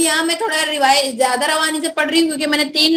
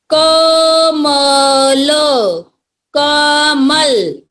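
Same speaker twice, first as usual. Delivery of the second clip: कमल stretched out slowly, syllable by syllable; slow drawn-out reading; much slower than usual. Shown as a demonstration. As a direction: draw out कमल for the class to repeat. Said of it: "मेरे बाद जोर से बोलना है". (धीरे धीरे खींचकर पढ़ना)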